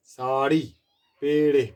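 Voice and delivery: ordinary talk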